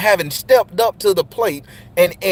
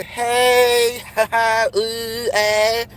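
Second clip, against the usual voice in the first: mocking sisters' voices; 4 semitones higher, much slower than usual. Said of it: "he getting that".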